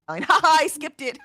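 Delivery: a quick real laugh before admitting it. Real, not acted. Host laughing as she admits skipping the Italian, real laugh.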